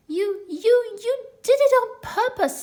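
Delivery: high loud spluttering through soap. Acted. Piglet with soap in mouth, high spluttering protest. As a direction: choke out the accusation. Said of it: "spluttered Piglet".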